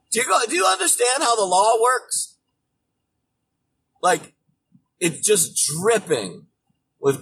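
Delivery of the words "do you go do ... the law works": shouted, condescending question; mocking shout. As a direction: yell it down at them in scorn. Mocking the senators.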